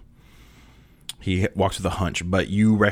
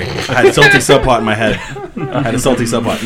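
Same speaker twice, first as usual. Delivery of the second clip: loud, higher, through real laughter; laughing; 5 semitones higher, 10 dB louder than usual. Real, not acted. Game master excusing a wrong name to the players, laughing. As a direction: crack up while explaining the slip.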